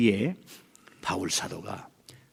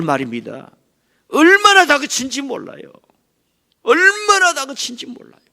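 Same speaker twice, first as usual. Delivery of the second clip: high, loud, driving sermon cry; full-force preaching; 11 dB louder than usual. Acted. Preacher to the congregation about Jesus' rebuke, full-force preaching. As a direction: preach it out at the top of the voice.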